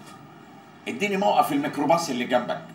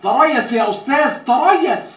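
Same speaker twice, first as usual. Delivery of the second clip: high, loud order to hold on; commanding shout; 8 semitones higher, 6 dB louder, slower than usual. Acted. Contract reader stops the fed-up boss leaving, commanding shout. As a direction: halt him with a raised, formal command.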